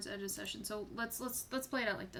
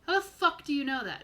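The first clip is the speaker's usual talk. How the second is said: higher, louder insistent repeat; pressing demand; 5 semitones higher, 10 dB louder than usual. Acted. Big guy gets in a stranger's face, pressing. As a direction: press the question harder, falling at the end. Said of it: "he gets in your face just a little bit".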